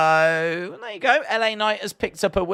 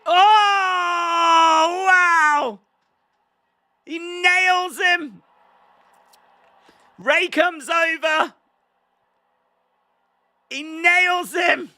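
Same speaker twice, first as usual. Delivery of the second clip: much higher and louder, slow stunned shouting; excited shout; 12 semitones higher, 4 dB louder, much slower than usual. Real, not acted. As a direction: yell each hit in disbelief. Rey attacks, crowd erupts.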